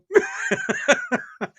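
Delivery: high, rolling laughter; amused laugh. Real, not acted. Answering no to selling watches, amused laugh. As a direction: burst into a high giggle.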